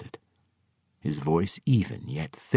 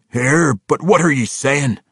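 loud gruff dwarf disbelief; can't believe what he hears; incredulous; 9 semitones higher, 9 dB louder than usual. Acted. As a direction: Winko questioning the old dwarf. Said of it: "an incredulous Winko asked".

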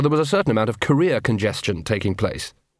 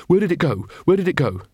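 higher-pitched urgent demand; urgent questioning; 5 semitones higher than usual. Acted. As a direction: ask urgently, pitched up, twice over. Bursts out sword in hand.

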